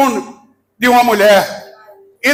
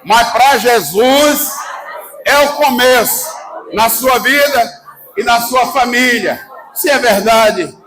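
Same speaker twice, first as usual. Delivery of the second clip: fiery preaching. Acted. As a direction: preach it full force to the crowd. Preacher fires up congregation.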